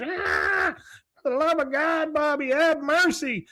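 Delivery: high-pitched mock scream; screaming for mercy. Dale chased by bees outside, screaming to Bobby for mercy. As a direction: wail it high, as if pleading for rescue. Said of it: "you hear Dale screaming".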